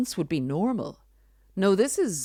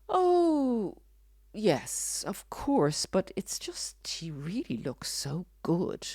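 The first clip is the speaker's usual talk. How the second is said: high drawn-out oh, then soft, slow sighing; exaggerated, mock-dreamy admiration trailing into near whisper; sarcastic wistful swooning; 4 semitones higher, 3 dB quieter, slower than usual. Narcissist gazing at ex's picture, wistfully sarcastic. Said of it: "I'm being very sarcastic and slightly humorous".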